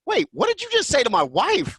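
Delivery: can't believe what he heard; shocked outrage. Retold or imitated. Jake stunned by the call.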